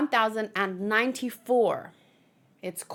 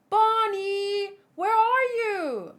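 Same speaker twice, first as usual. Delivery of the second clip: higher, slow worried call; 9 semitones higher, 4 dB louder, much slower than usual. Acted. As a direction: call her name, searching. Calling for lost girl.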